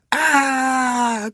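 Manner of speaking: long high-pitched screech; woman's screech. A narrator's reading. Woman who lost hat and wig, screeching. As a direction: screech on one drawn-out high note. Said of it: "kreischte die Frau".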